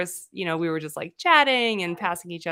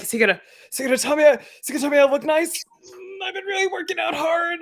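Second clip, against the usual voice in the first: fast, high, eager preening; husband fishing for a compliment; vain husband voice; 10 semitones higher, 4 dB louder, faster than usual. Retold or imitated. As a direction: rush it like a hopeful show-off. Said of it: "He's like".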